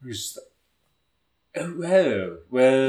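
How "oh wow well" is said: put-on British-vampire-meets-surfer accent, high and loud; Nicolas Cage. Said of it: "it's halfway between British vampire and surfer".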